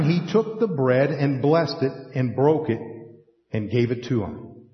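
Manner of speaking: normal talking voice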